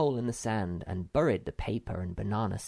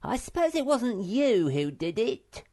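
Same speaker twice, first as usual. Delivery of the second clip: suspicious; 12 semitones higher, 5 dB louder than usual. A narrator's reading. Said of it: "looked suspiciously at her".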